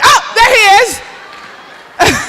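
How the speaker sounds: drawn-out high startled oh; surprised oh. Real, not acted. Host startled as the presenter appears beside her, surprised. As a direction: caught off guard by him appearing. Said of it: "You scared me".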